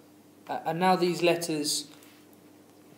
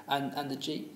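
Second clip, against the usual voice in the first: ordinary talk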